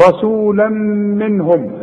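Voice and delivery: رسولا منهم with the tanween merged into the meem, said slowly; رسولا منهم with a slow merged nasal. Shown as a demonstration. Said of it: "الميم من حروف الادغام".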